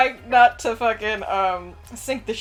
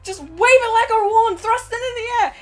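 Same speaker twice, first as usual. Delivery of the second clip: high, urgent captain's instructions; exasperated captain; 12 semitones higher, 4 dB louder than usual. Acted. Captain telling the elf how to fire, urgent. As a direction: urgently coach a clueless crewmate, pitched high. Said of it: "the captain had an Australian accent".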